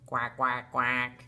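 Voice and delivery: low, level duck quacks; low-pitched imitation of a duck; duck quacking. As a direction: quack three times like a duck. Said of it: "Mother duck is quacking".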